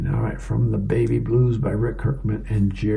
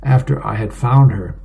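ordinary delivery

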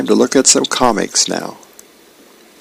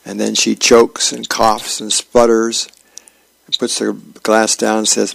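usual speaking voice

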